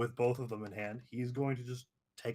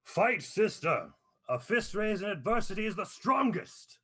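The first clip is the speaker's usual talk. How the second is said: rallying yell; 11 semitones higher, 6 dB louder than usual. Acted. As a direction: yell encouragement to a fighter over the crowd. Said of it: "he will yell".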